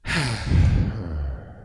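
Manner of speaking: voiced, falling sigh on the exhale; long audible sigh sliding down; sigh with sound. Shown as a demonstration. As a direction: let the outbreath carry an uncontrolled sound. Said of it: "Give it a sound".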